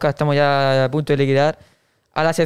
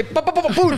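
high, punchy pum pum sounds; playful high sound effect; mimicked quick pops; 10 semitones higher than usual. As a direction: pop them out fast like a rapid-fire gadget. Mimicking her quick moves.

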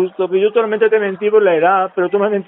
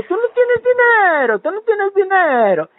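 much higher, slower mocking Emma voice; imitating Emma; 10 semitones higher, much slower than usual. The prankster replays Emma's taunt about money, high and slow. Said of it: "me dijo".

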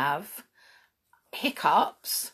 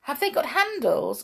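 huffy little helper elf; 7 semitones higher than usual. Acted. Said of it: "asked the brownie".

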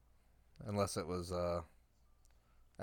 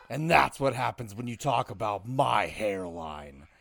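Randy Orton style promo, getting louder through the rant; 3 dB louder than usual. Pretend. Answering his co-host's promo, in a louder Randy Orton style heel promo. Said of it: "I'm gonna do it in my Orton voice".

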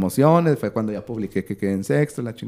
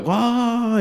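a long stretched goal scream, pitched way up; goal call; 12 semitones higher than usual. Shown as a demonstration. Narrator showing how he called the Cruz Azul goal, stretched excited yell. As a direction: sell the goal like a live sportscaster.

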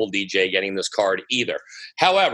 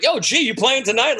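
door guy greeting; 12 semitones higher than usual. Retold or imitated. Door people greeting him.

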